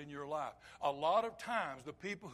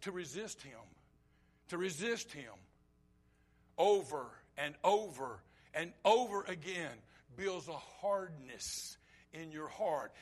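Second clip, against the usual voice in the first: ordinary talk, nothing marked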